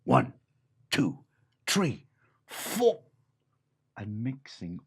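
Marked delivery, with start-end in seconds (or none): 0.0-3.0 dog's count-in, rising on four. Acted. The dog counts the animals in for their music. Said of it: "and the dog said".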